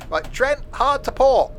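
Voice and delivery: loud, high call. Pretend. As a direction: call it out loudly. Calling out to Trent.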